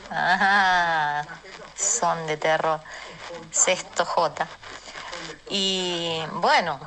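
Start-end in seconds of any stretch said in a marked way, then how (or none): none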